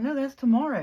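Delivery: mock boss raising his voice to insist; insistent repeat.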